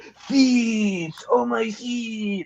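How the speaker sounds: put-on high, drawn-out Transylvanian accent; mock Transylvanian accent. Reader imitates the audiobook's Fool, hammed-up Transylvanian accent. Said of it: "like, Transylvania".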